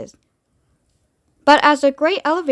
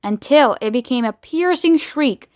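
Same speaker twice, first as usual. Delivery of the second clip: usual speaking voice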